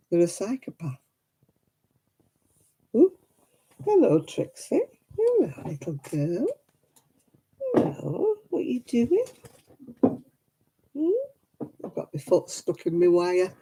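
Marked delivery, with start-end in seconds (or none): 3.0-11.1 high, sing-song cooing at the dog; pet talk. Acted. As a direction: coo sweetly to your pet like a baby.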